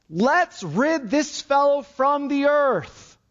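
high, drawn-out cry against him; mob's cry. Acted. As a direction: call it out slowly as the crowd. Acting the mob seeking to kill.

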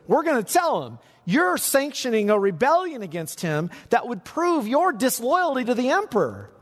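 high, pressing voice of the accusers; threatening accusers. Acted. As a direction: lean on Pilate with a veiled threat. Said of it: "what they were saying is".

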